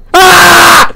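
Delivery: high, frightened yell; panicked scream. Acted. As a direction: scream out in alarm. Screams during the confrontation.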